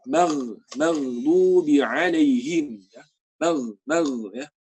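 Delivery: flow through the gh in maghdubi; smooth ghain. Shown as a demonstration. Teacher modelling a smooth ghain. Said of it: "Biarkan mengalir gitu".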